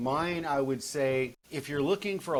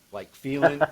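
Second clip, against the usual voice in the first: New Jersey drop of g; 2 semitones higher, 4 dB louder than usual. Shown as a demonstration. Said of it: "New Jersey dialogue".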